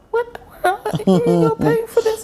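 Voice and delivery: high-pitched mock disbelief; incredulous inner voice. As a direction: squeak it as an astonished aside to yourself. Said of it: "I'm saying to myself".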